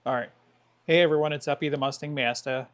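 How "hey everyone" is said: ordinary talk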